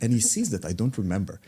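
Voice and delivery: ordinary talk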